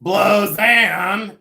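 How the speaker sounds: high, loud drawn-out name; imitating Judy Tenuta. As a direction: call the name out like a comic. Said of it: "She'd go".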